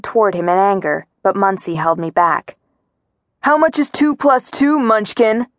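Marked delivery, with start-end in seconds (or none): 3.4-5.5 higher-pitched taunting yell. A narrator's reading. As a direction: call out a mocking question. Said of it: "Ripley yelled".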